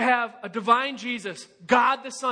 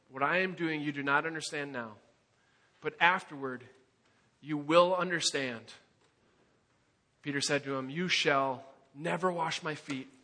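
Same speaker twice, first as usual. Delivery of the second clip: hushed scripture reading; 9 semitones lower, 5 dB quieter than usual. Acted. Reading Jesus and Peter dialogue.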